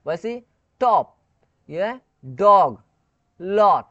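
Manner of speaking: टॉप, डॉग, लॉट drawn out with an open "aa" vowel; slow teacher demo of टॉप, डॉग, लॉट; pronunciation demo. Shown as a demonstration. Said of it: "ओ का आ pronunciation".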